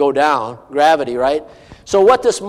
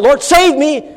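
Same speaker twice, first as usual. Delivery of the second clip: sudden high, loud plea for rescue; crying out; 9 semitones higher, 6 dB louder, slower than usual. Acted. Sinking disciple crying to his Lord, loud desperate plea. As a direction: cry out like a man sinking. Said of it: "cries out".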